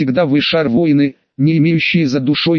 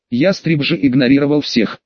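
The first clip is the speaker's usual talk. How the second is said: ordinary delivery, nothing marked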